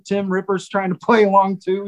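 usual speaking voice

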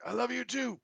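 high but soft imitation of a distant shout; mock holler, kept small; retold yell back. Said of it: "And he yelled back".

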